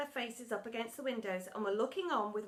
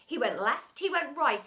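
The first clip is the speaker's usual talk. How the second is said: plain reading voice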